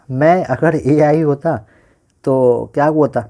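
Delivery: slow, drawn-out "मैं अगर AI होता". Real, not acted. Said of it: "मैं थोड़ा सा स्लो स्लो बोल रहा हूँ". (धीमे-धीमे "मैं अगर एआई होता")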